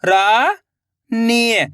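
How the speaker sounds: Rani stretched very slowly, each sound held long. Shown as a demonstration. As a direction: drag out Rani for the learner. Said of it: "तो मिलके बन जाएगा". (रानी को बहुत धीरे खींचकर बोलें)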